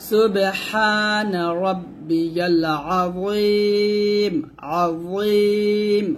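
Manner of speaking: slow সুবহানা রব্বিয়াল আযীম with a thick য in আযীম; slow recitation with a heavy য. Shown as a demonstration. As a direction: stretch আযীম, thick য, long vowel. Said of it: "জটা মোটা করতে হবে".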